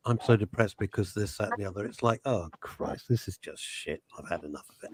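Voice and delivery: gloomy mutter. Retold or imitated. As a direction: let each phrase sink down, sighing with gloom. Voicing a depressed man's thoughts.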